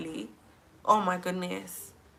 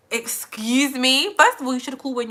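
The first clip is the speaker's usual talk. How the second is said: indignant; 5 semitones higher, 6 dB louder than usual. Real, not acted. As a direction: draw it out, higher, taking offence. Indignant at delivery man's rudeness.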